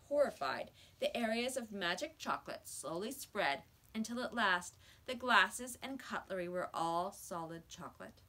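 usual speaking voice

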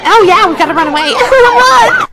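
fast, loud, laughing panic; panicked rush. Retold or imitated. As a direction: rush it like fleeing. Fleeing police panic.

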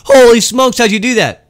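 hammed-up coworker amazement; amazed. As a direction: gasp it out in amazement.

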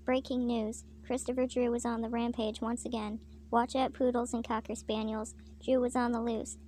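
ordinary delivery, nothing marked

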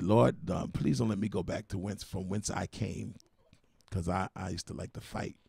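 low, hushed plea under the breath; whispered prayer. Retold or imitated. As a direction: pray quietly so nobody hears. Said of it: "I had to pray".

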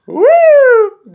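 high, loud mock siren whoop. Imitating the show-offs' jeep siren with a loud whoop. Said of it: "jipleri varsa dat dat dat".

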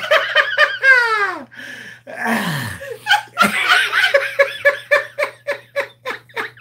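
long, high helpless laugh; odd laughing fit. Real, not acted. Co-hosts cracking up over a card, long odd laughing fit. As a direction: laugh till only wheezes are left. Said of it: "What kind of laugh is that?".

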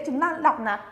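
ordinary delivery

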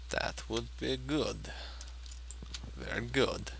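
put-on slow, drowsy Kazakh-accented Borat voice; sleepy Borat impression. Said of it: "That was a sleepy Borat impression".

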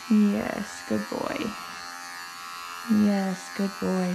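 quiet soothing pet talk; soft praise for the dog. Real, not acted. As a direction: hush him with praise.

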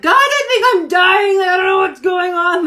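high, loud dramatic wail of alarm; loud, high-pitched mock desperation; retold panic. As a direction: cry out as if in agony, hammed up for the story. Retold panicked message to group.

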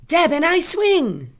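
high, loud excited cry; exclamation. Shown as a demonstration. Teacher tests an exclamation mark for pupils, exclaiming. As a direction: shout it out with big feeling. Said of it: "Is it going to be an exclamation mark?".

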